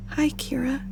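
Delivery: croaking, weak whisper; croaky. Acted. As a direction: croak it out, unwell. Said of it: "she croaked".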